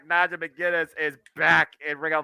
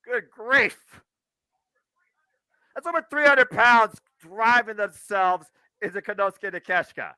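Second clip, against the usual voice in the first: wrestling commentator; 4 dB louder than usual. Acted. Live play-by-play of match.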